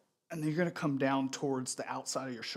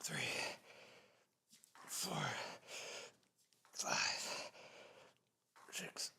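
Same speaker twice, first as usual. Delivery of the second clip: strained whisper; 3 semitones higher, 12 dB quieter, slower than usual. Real, not acted. Counting reps mid set.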